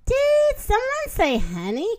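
high, eager call about honey; excited cry. Acted. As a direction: perk up and cry out at the word honey. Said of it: "cried the hat".

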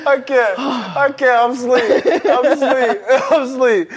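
helpless high-pitched laughing; laughing to tears. Real, not acted. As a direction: gasp out short phrases, real laughter taking over. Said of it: "I'm really crying right now".

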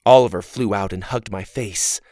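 ordinary delivery

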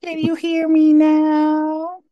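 high slow squeaky voice; high slow mock. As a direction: stretch it out up high. Echoing the ad line.

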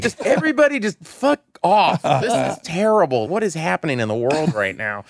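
high, loud despairing wail, easing down; mock meltdown. Exhausted man hiding under covers, high mock-despairing outburst. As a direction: start in hysterics, then settle slightly.

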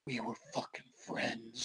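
soft, low, unhinged muttering; low eerie murmur. Retold or imitated. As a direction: hiss it with crazy wide eyes. Homeless guy staring with crazy eyes.

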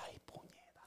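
breathy whispered curse under the breath. Retold or imitated. Killer curses to herself on finding the baby, whispered. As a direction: hiss it like a secret.

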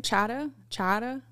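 "Chowder" as "chah-duh" in a Maine accent; attempted Maine accent. Shown as a demonstration. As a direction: try Maine "Chowder", dropping the r, questioning. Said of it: "see if you can do".

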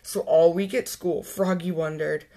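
plain reading voice

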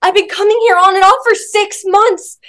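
insist, close to crying; near tears. Retold or imitated. Telling the gym staffer, voice close to tears. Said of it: "literally almost in tears".

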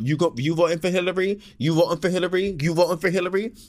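imitating her pushy questioning. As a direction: mimic her badgering each rider, quick and insistent. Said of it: "Each person talking about".

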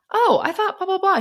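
very high-pitched blah-blah; high, airy stand-in for small talk; mock chatter. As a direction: imitate breezy chatter way up high. Acting her own casual chatter.

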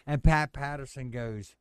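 ordinary talk, nothing marked

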